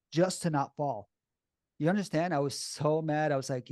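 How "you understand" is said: ordinary delivery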